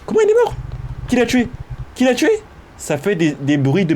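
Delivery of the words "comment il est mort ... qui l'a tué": squeaky high disbelief; a shrill, stunned squeak; shocked. Acted. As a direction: gasp out the question in shock. Shocked question about dead computer.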